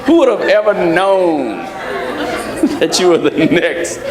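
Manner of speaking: laughing through the words at the mix-up; chuckling while repeating the punchline. Real, not acted. Preacher cracking up at his partner's cue, laughing.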